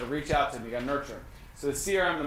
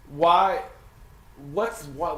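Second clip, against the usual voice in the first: ordinary delivery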